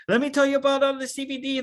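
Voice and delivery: fast, high rushed sales spiel; mock someone fire-hosing a friend; hammed-up pushy seller; overeager pitch.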